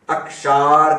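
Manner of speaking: अक्षार with a long drawn-out aa in षार; lengthened second vowel. Shown as a demonstration. Teacher adding a vowel sign to the word, अक्षार said with long aa. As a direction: stretch the षा of अक्षार. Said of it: "एक मात्रा और बढ़ा दें आप".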